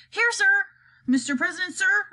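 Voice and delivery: high, loud eager spy voice; eager chief spy. Acted. Chief spy answers the president, high and eager. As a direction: snap to attention, high and loud. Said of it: "said the chief spy".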